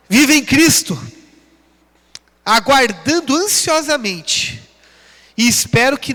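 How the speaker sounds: loud, rising preacher's call to the congregation; preaching at full force. Acted. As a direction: fire them up and ask for their amém. Rallying the congregation, calling amém.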